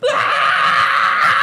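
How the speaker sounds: high, loud wild-animal yell; wild attack yell. Retold or imitated. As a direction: shriek like you're pouncing. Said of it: "You go".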